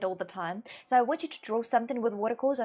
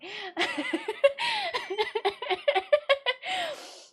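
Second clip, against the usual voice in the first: high-pitched laugh. Real, not acted. Laughs at forgetting they play.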